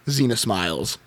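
normal talking voice

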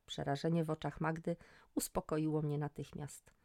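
plain reading voice

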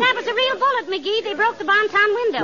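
high, startled alarm. Acted. Molly to McGee after a real bullet, alarmed. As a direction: gasp it out as the glass breaks.

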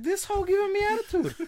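high, loud squeal through laughter; high laughing disbelief; laughing mock outrage. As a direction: shriek it out, cracking up. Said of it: "I'm like".

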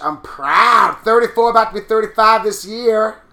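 sassy brag about age; loud proud announcement; proud boast. Real, not acted.

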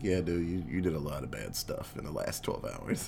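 very low, soft reply; retold hushed admission. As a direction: break it to him low and quiet. Retold awkward breaking of bad news.

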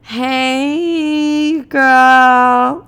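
long, high, droning hello; stoned awkward greeting. Retold or imitated. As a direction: imitate a dazed stoner stretching out the word. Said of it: "I looked at the ground and went".

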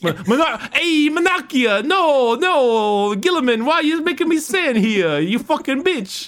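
Lorgar as the Fonz. Acted. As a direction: swagger into a cartoon Italian tirade. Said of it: "fucking Logar is like".

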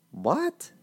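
high, baffled rising what; a shocked, disbelieving squeak; stunned what. Retold or imitated. Confused at the polling station.

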